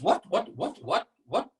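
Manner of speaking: rapid-fire bewildered questions; a brain caught off guard, repeating itself; startled confusion. Acted.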